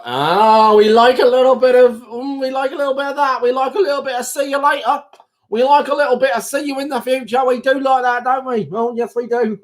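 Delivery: high, put-on gushing voice; gleeful cooing. Streamer relishing a "see you later", gleeful put-on cooing. Cooing over a friendly sign-off.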